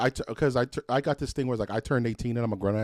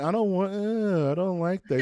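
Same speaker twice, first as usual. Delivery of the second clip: put-on whiny kid; 8 semitones higher than usual. Kid refusing, voiced in story.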